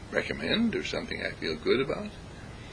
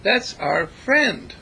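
slow, high, louder defiant warning; woman scolding soldier; 11 semitones higher, 4 dB louder, slower than usual. Retold or imitated. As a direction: draw it out fiercely. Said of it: "this woman taking a broom".

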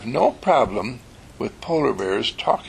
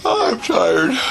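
put-on sleepy child; 9 semitones higher, 5 dB louder, slower than usual. Martino yawns.

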